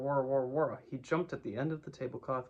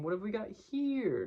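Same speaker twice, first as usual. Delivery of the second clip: slow, higher, musing tasting voice; considering noises; 9 semitones higher, 3 dB louder than usual. Acted. Tigger tasting honey, slowly mulling it over. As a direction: roll the words around like tasting honey. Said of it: "and "..." noises".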